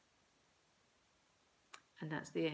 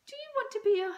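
breathless hopeful mum; 7 dB louder, much faster than usual. A narrator's reading.

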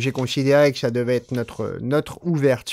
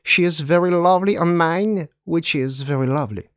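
poem reading; 5 semitones higher than usual. Acted. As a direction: savour each repeated sound of the verse. Poem recitation continues.

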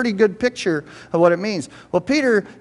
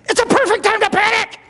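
high-pitched panicked yell; Woody; 5 dB louder than usual. Acted. Cartoon sheriff under the car answering Buzz, in loud panic. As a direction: shriek it in a hammed-up cartoon panic. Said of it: "And what does Woody say?".

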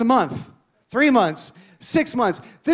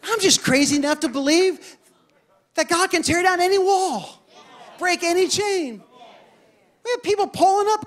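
higher pitched fervent preaching; 7 semitones higher than usual. Acted. Pastor proclaiming God breaks chains, fervent high preaching. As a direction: drive the faith claim home.

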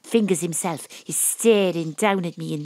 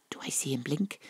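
whispered aside; 4 semitones lower, 10 dB quieter than usual. A narrator's reading. Narrator's inner aside.

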